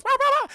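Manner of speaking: very high, loud yapping bark; squeaky mock woofs; Zero. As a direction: imitate a little dog barking. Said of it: "But then Zero goes".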